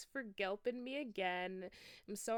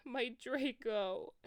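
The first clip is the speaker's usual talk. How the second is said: slow, higher mournful moan; 6 semitones higher, 5 dB louder, much slower than usual. Acted. Vampire mourning his lost love, slow mournful moan. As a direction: draw it out in grief. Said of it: "he moaned".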